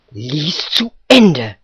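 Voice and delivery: slow, loud, menacing order; woodcutter's heavy, deliberate threat; threatening. A narrator's reading. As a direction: give a drawn-out warning command. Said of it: "sagte der Holzfäller drohend".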